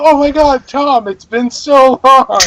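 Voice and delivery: high, drawn-out delighted greeting; gushing greeting. Acted. Player greeting guard Tom like an old friend, gushing. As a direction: gush as if reunited with a long-lost friend.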